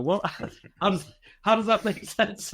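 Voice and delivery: mock puzzlement, breaking into laughs; amused disbelief. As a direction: retell their bafflement through laughter. People reacting, laughed through retelling.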